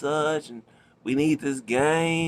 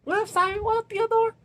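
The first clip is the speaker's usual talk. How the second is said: squeaky sped-up chipmunk, much higher; teasing his younger self with a very high chipmunk squeak. Retold or imitated. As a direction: pitch it way up like a cartoon chipmunk and crack up at the end. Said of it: "we sounded like little chipmunks".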